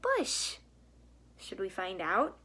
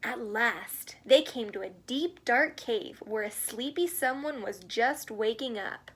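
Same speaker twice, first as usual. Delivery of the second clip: gentle storybook read-aloud; reading to children; slower than usual. A narrator's reading. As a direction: read it like a bedtime picture book. Reads picture book to children.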